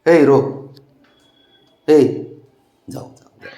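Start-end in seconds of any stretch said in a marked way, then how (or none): 2.9-3.5 whispered to the dog. Real, not acted. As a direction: breathe the words softly to the dog. (कुत्र्याशी कुजबुजत)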